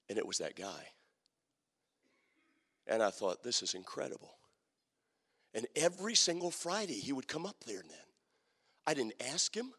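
low, soft, slowed preaching; soft, intimate storytelling at the turning point; hushed sermon story. Acted. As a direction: draw them in, near a hush, as it turns. Tender climax of the story.

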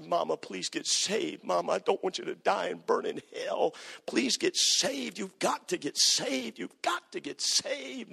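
high, slow, begging plea; pleading son. Retold or imitated. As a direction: beg mama to get saved, voice lifting. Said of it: "and I'd say".

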